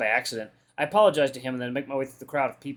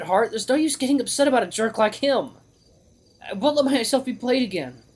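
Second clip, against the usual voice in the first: high-pitched defiant outburst; upset self-argument; 9 semitones higher, 3 dB louder than usual. Acted. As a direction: argue yourself out of it, voice pitched high. Hero argues with himself, upset.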